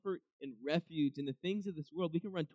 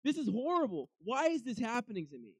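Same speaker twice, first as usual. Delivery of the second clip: anguished lament; 7 semitones higher, 4 dB louder than usual. Retold or imitated. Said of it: "Being like".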